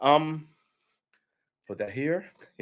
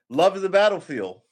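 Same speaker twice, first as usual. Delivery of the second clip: excited; 8 semitones higher, 10 dB louder than usual. Real, not acted. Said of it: "It's one of my favorite songs".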